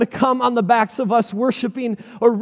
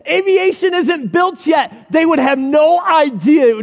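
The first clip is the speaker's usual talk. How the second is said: mock pleading disciple; 6 semitones higher than usual. Disciple lecturing Jesus on a grand entrance, hammed-up half-shout. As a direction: argue with Jesus like he's missed the obvious.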